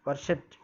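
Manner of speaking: वर्शिप्ड ending in a crisp ट sound instead of ड. Shown as a demonstration. Teacher showing the final ट in वर्शिप्ड. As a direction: finish वर्शिप्ड on a sharp ट. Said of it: "और डा की जगह टा".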